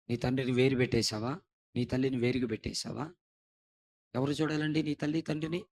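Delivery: ordinary talk, nothing marked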